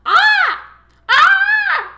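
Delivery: obnoxious high-pitched screech; very loud, shrieking mock eagle cry; ridiculous bird call. A narrator's reading. Tom mimics Daphne's scream, obnoxious bird screech. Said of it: "ridiculous, obnoxious, high-pitched bird call".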